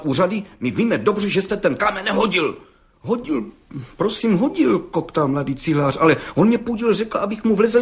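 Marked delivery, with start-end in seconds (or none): 3.1-4.8 high, nervous stammered admission; stammering. A narrator's reading. Young brickmaker stammering before the judge. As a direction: stutter it out, cornered and scared.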